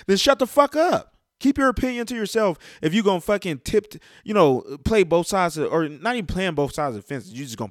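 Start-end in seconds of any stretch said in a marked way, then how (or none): 0.1-3.2 higher and louder, real anger; angry shout of shut the fuck up; angry outburst. Real, not acted. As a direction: snap it at them. Angry rant at fans.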